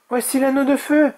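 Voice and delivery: put-on very high, anxious young dragon; high-pitched apprehension; worried. A narrator's reading. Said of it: "s'inquiéta Oria".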